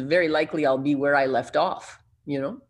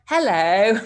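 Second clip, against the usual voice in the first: a put-on British phone greeting, rising; British accent; 6 semitones higher, 7 dB louder than usual. Back on the line to the guy, mock British accent, laughing. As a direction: fake British "hello" through a laugh. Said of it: "putting on a British accent".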